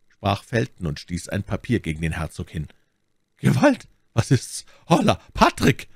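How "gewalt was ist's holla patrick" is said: high, loud alarmed shout; calling for help. A narrator's reading. Buckingham under attack summoning Patrick, alarmed shouting. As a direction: call out urgently for the servant.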